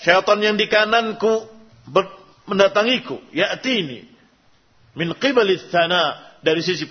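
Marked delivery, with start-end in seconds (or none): none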